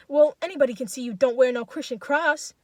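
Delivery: loud, indignant call; voice from crowd. A narrator's reading. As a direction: call out reproachfully over the hubbub. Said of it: "comes from a hubbub of voices".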